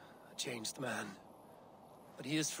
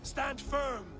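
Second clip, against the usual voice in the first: rallying battle cry; 12 semitones higher than usual. Acted. Eivor rallies the warriors before the final battle, shouting. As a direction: whip the army into a fury.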